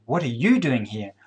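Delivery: single out "you" with a jump in pitch; stress on you. Shown as a demonstration. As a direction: put all the weight on "you". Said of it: "place emphasis on any one of those words".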